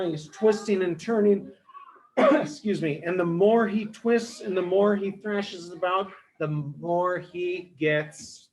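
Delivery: ordinary delivery, nothing marked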